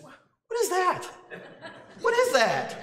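high, incredulous "what is that"; shocked half-laugh at what he heard; retold disbelief. Listening to the preacher, reliving his disbelief in the crowd. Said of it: "I'm thinking".

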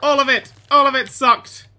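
high, loud gamer war cries; battle cry. Pretend. Player attacks the waiting dinosaur, high battle shouts. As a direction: yell each strike in gleeful attack.

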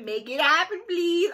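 high, loud giggly imitation; laughing mock plea. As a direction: parrot the plea through laughter. Mocking her old clip's plea.

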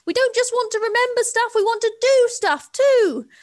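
high, loud, slowed shout; proud eager shout; excited shout. Acted. Elephant birds announcing they want to act, loud.

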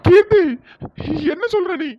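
loud, high jolt of alarm; shocked outcry. Acted. Brother hears his sister's news about Riya, shocked outcry. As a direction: cry out in shock, then stay high.

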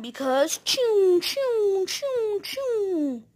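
rising choo choo train imitation; train noise. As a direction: chug like a train, pitching up.

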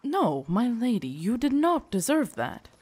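insistent, pitch swinging high; earnest protest. Acted. Servant assuring his queen she did not deserve it, earnest protest. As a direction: defend her firmly, voice lifting.

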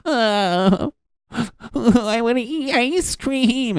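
high, slow, whimpering plea for ice cream; whiny weakling voice. Acted. The weak side of his brain begs for ice cream, whimpering. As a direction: cry it out like a pathetic little brat. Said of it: "My pussy brain".

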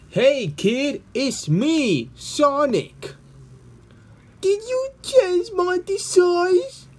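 high, eager cartoon hedgehog voice; Sonic voice. Sonic greets the kid.

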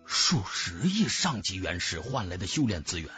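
ordinary delivery, nothing marked

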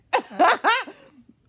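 a big high laugh bursting out; loud high burst of laughter. Real, not acted. Laughing out loud at her own prank text, loud high cackle.

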